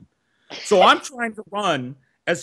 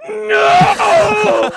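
much louder, higher strangled cry; desperate cry; 11 dB louder than usual. Retold or imitated. Pinned kid gasping at his attacker to stop, strangled shriek. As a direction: force the word out in a choked yelp. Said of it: "all that came out was".

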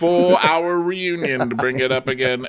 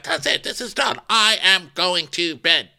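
raised-pitch, exasperated announcement; mock fed-up king; 7 semitones higher than usual. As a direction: end the quarrel in a comic huff. Acted fed-up king ending the quarrel.

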